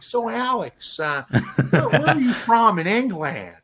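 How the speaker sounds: high, laughing laid-back American voice; high drawling American, laughing; Owen Wilson impression. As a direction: Owen Wilson voice, chuckling through it. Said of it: "standing there like proper Owen Wilson".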